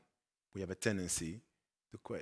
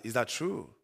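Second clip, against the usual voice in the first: ordinary talk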